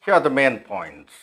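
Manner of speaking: slow delivery with MAIN and POINTS prominent; newsreader-style opening, weight on MAIN POINTS; sentence stress demo. Shown as a demonstration. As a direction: lean on MAIN, measured pace. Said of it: "यहाँ पर stress है".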